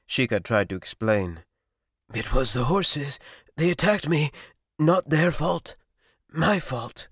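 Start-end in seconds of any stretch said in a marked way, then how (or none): 2.1-7.1 a little higher, halting confession; lightly voiced fevered boy. A narrator's reading. Young man confesses to the sorcerer, faintly higher and strained. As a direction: own up haltingly.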